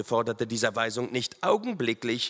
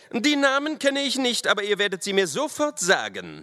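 high, forceful command; officer voice pitched high and insistent; demanding officer; 12 semitones higher, 4 dB louder than usual. A narrator's reading. Lieutenant demands the riders' names, stern.

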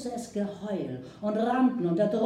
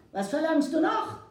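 indignant demand; 9 semitones higher, 4 dB louder than usual. Acted. Chief demands, angry.

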